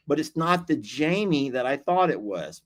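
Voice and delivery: normal talking voice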